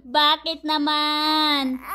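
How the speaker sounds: loud, slow mock wail; playful dismay. Real, not acted. Exclaiming over crying baby. (malakas at pahabang panunuyo)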